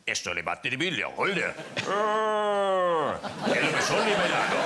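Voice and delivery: light mock Spanish dub voice; lightly hammed-up Spanish dubbing voice; sexy Spanish voice. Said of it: "very sexy Spanish voice".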